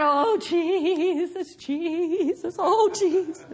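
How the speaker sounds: drawn-out, wailing mock prayer; mock pleading. Preacher mimicking worried believers walking around, mock pleading. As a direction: moan it like a helpless believer. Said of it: "If we're walking around".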